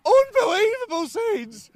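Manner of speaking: high, loud, slow shout of disbelief; excited yell. Real, not acted. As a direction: scream it like the scenes are unreal. Commentator yelling at the scuffle.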